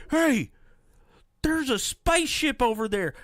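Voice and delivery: high, eager call, mocking the guy; excited Walton. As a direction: point at the ship like a giddy kid. Walton voiced spotting the ship.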